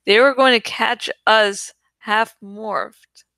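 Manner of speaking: usual speaking voice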